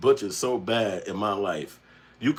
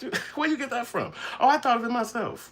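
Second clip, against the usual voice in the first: mock friends; 14 semitones higher than usual. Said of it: "Be like".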